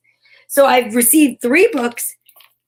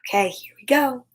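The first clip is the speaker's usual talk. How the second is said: ordinary delivery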